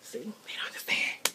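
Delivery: a soft confiding whisper; whispered aside. Real, not acted. Vlogger whispers to viewers about her noisy children. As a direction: whisper it to the camera.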